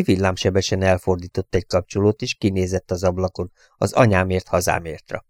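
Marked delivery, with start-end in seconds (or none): none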